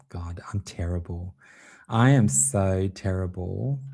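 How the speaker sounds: normal talking voice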